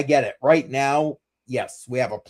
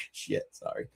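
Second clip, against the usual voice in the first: breathless laughing; 15 dB quieter than usual. Real, not acted. Laughing apology after outburst.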